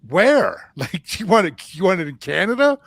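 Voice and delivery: laughing at how absurd it sounds; mock shocked question; retold disbelief. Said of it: "And I'm like".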